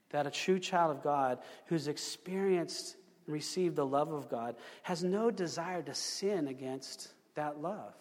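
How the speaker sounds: ordinary delivery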